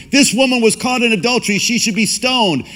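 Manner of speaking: high, fast, indignant accusation; accusing crowd. Acted. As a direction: hurl the charge like a self-righteous accuser. Crowd accusing the adulterous woman.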